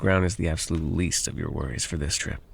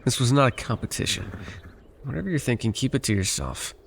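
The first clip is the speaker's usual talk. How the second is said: irritated retort; 6 semitones higher than usual. Acted. Annoyed at smug companion.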